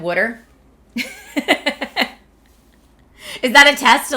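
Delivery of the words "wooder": "Water" as Philly "wooder"; Philly accent. Shown as a demonstration. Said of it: "Say water in a Philly accent".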